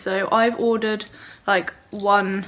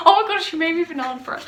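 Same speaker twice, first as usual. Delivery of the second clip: high, excited burst; delighted surprise; 7 semitones higher, 4 dB louder, faster than usual. Real, not acted. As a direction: react with real, sudden delight. Delighted discovery of friend's gift.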